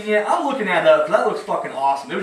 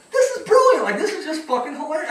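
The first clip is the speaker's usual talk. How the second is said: laughing; 10 semitones higher than usual. Real, not acted. Laughing at the movie scene.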